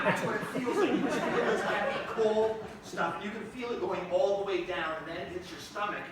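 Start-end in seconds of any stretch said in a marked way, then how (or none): none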